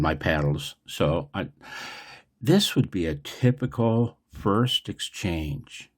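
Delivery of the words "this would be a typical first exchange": usual speaking voice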